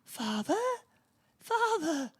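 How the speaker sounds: high cry of Father, rising to a wail; a hammed-up son calling his father; Tommy calling out to his father, mock anguished. Son crying out in retelling.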